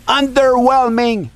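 loud, high-pitched yell; shouted take. Acted. Narrator redoing his review line, shouting. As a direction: blast the word out at full volume.

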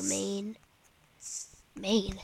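"man" pronounced like "Maine". Real, not acted. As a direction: say "man" with the long vowel of "Maine". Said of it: "Why do you say Maine?".

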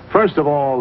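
ordinary talk

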